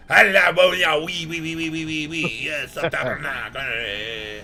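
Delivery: hammed-up Quebec French imitation; Quebecois French accent. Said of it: "the Quebecois French is like".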